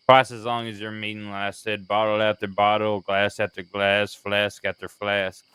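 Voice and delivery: words slurred from drinking. Real, not acted. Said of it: "You sound like you're slurring your words".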